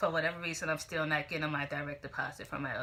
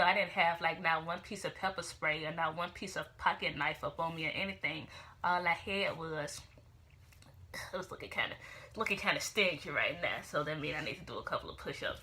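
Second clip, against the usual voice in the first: usual speaking voice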